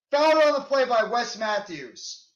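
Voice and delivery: ordinary talk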